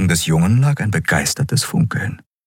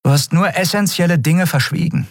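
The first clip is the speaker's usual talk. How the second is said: higher-pitched, brittle sarcasm; dry reproach; 12 semitones higher than usual. A narrator's reading. Captain to the man who hid things, drily. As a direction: needle him drily. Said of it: "Endriel lächelte spröde".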